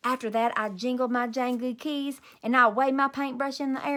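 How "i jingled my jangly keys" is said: ordinary delivery, nothing marked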